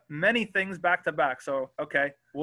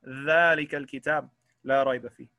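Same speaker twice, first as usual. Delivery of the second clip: quick recited Quran verse; Quran recitation; much faster than usual. Shown as a demonstration. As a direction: recite it like tajweed. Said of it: "like that".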